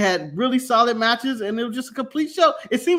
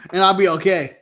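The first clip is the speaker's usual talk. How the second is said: normal talking voice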